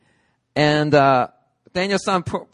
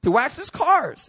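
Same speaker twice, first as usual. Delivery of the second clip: much higher, complaining whine; mock teen complaint; upset teen; 14 semitones higher, 3 dB louder than usual. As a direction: whine like a fed-up kid. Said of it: "He's all upset".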